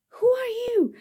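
breathless whisper, voice gone; whispered question. A narrator's reading. Little spider whispers to the giant hairy spider. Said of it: "asked Spinderella".

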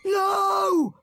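put-on high shrieking child's no; screamed no. Henry screaming at Mum. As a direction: scream it like a furious boy. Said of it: "screamed Horrid Henry".

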